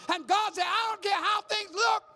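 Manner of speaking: loud, high preaching, the last words slowed right down; preaching climax. Acted. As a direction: thunder God's promise to the church. Preaching climax voicing God.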